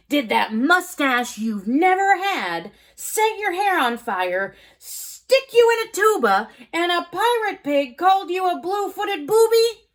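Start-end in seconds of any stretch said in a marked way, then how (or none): none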